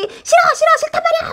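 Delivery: high, loud whiny screaming "싫어"; child's tantrum yell. Retold or imitated. Parent imitating a screaming child's tantrum. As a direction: shriek it like a child throwing a fit. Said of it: "하고 막 고래고래 소리를 지르고".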